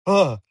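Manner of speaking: loud, high shocked grunt; shocked ugh. Retold or imitated. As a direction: recoil with a sharp ugh. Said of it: "I showed the shocked face".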